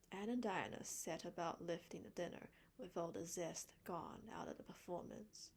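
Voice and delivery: usual speaking voice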